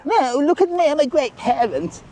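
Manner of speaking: lampoon a parent showing off to the whole queue; mocking show-off parent. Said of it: "sort of put across that they're".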